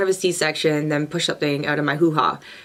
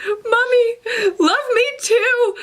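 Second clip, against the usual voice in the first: put-on high, whiny little-kid plea; hammed-up needy squeal; mock yell; much slower than usual. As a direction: wail for mommy's attention, drawn out. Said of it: "And I yell this".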